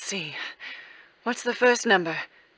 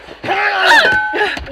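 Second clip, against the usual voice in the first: gleeful laugh; 9 semitones higher, 6 dB louder than usual. Acted. Gloating after the candlestick hit.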